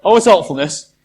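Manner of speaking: loud, pushy, businesslike plan; mock brisk resolve. Pragmatist mimicked by the speaker, loudly and briskly planning their moods. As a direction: bark out the day's emotional itinerary like a to-do list. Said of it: "you get up in the day and go".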